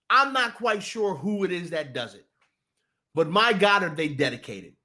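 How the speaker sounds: ordinary talk, nothing marked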